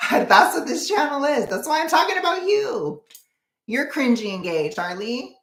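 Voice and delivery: gleefully roast the viewer, keeping it high; giddy teasing rant. Real, not acted. Streamer teasing viewer Charlie about her cringy channel, giddy and high.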